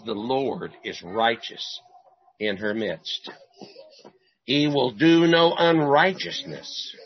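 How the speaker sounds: slow solemn reading; scripture reading. Real, not acted. Preacher reading Zephaniah to the congregation, solemn. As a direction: read each phrase with weight.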